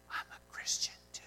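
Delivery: hushed, secretive whisper; whispered confession on a plane. Retold or imitated. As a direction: lean in and whisper it. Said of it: "why is he whispering this to me".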